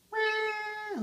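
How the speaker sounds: put-on high, wailing baby cry "wah"; toddler bawl. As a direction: wail it like a toddler throwing a fit. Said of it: "Trixie bawled".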